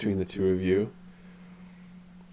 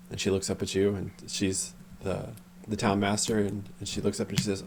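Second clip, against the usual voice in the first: ordinary talk, nothing marked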